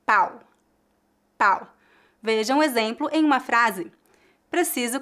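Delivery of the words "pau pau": Pau with an open, oral A gliding to u. Shown as a demonstration. Teacher models Pau for learners, open oral A. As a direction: say Pau with a wide open A and no nasal. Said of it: "feita com o A aberto".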